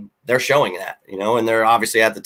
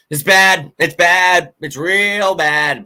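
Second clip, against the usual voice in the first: frustrated outburst; 5 semitones higher, 4 dB louder, much slower than usual. Real, not acted. Venting about team.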